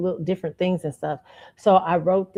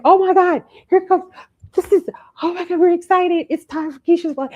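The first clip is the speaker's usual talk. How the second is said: much higher, louder, gushing excitement; excited classmates; 12 semitones higher, 5 dB louder than usual. Retold or imitated. Imitating the class cheering for her play, gushing and high. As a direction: squeal it like the whole class is thrilled. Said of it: "Everybody's like".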